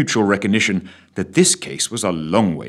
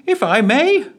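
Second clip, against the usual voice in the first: high, fussy official voice; pompous official chiming in; secretary; 5 dB louder than usual. Acted. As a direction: play the defence secretary, eager. Secretary character voice.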